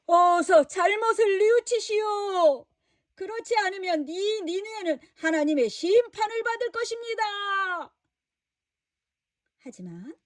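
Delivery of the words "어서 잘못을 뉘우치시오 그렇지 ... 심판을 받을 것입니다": higher, louder proclaiming to the city; loud proclamation. Acted. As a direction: call out a warning to the crowd. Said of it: "큰 소리로 외쳤어요". (니느웨 백성에게 높은 소리로 외치는 요나)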